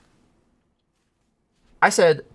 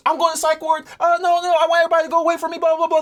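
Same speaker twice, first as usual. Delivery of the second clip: high, fast, panicky imitation of her; hammed-up high frantic rant; mock frantic girlfriend; 11 semitones higher, faster than usual. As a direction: mimic the stressed girlfriend pushing everyone away. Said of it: "She gets this way whenever she's stressed".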